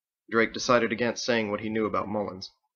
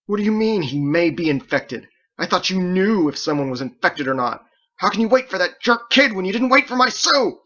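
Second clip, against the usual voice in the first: much higher, loud, outraged shouting; furious accusation; 9 semitones higher, 9 dB louder than usual. A narrator's reading. Grieving Bo confronting Andelin over his Sue, furious shout. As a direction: hurl it at her in grief and rage.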